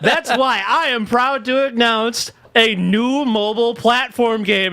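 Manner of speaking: high, proud fake-excited pitch; mock Diablo announcer. Mocking the Diablo mobile reveal, high proud voice. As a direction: announce it with hollow pride.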